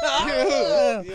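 high, strained moaning cry; zombie mermaid voice. Pretend. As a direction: groan like a wounded zombie mermaid. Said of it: "do your zombie voice, your mermaid voice".

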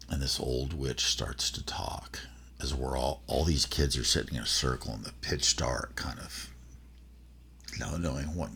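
hushed, creepy campfire narration; spooky storytelling whisper. Acted. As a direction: draw them into the dark, half-whispered. Spooky build-up to mom's story.

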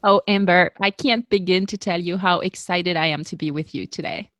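ordinary talk, nothing marked

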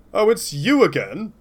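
unsure stab at an American voice; American accent attempt. Keith Hernandez greeting the player, in a wobbly American accent. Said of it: "I don't know what this accent is".

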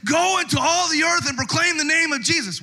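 high shouted preaching; mock fiery sermon line; fiery preacher. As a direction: proclaim it like a revival preacher. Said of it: "the pastor that goes".

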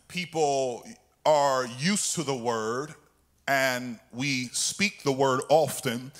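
low, soft, measured teaching with pauses; calm, deliberate explaining after the fervor; slowed down. Real, not acted. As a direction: bring it down and lay it out phrase by phrase. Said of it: "Let me slow down a little bit".

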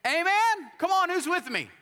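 high, loud, ringing preacher's cry to the congregation; full-force preaching. Acted. Pastor rallying the congregation after the hurricane story, full-force preaching. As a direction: lift the church with each repeated line.

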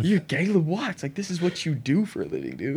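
high-pitched incredulous friend; mock disbelief. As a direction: protest like a baffled buddy, imitated.

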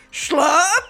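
loud, high questioning call; curious site. Acted. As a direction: pipe up loudly with a rising question. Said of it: "it sits up and is like".

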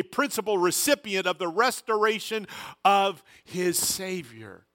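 rising, emphatic preaching of scripture; fervent preaching. Acted. Pastor preaching Peter's words to the congregation. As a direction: preach the verse with weight.

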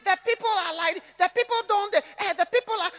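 mock them like a whining baby; mocking the congregation's complaints as babyish. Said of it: "stop talking like a baby".

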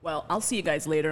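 ordinary talk, nothing marked

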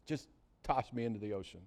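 low, very soft surrender; subdued, acted resignation; resigned murmur. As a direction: give up quietly. Jonah resigns himself to drowning.

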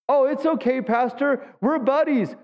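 a lightly voiced churchgoer brushing off the pastor; mock carefree churchgoer. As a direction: toss it off like a naive believer. Mocking a churchgoer's excuse.

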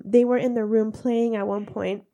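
ordinary talk

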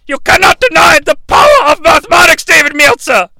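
high, loud, over the top; mock-grand shout. Comic lectures Dave on math, mock-grand shout. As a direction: thunder it at Dave like a prophet.

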